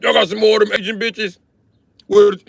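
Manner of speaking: high, loud mock soldier calling out from the bushes; mock baby boomer soldier. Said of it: "That's how they was talking".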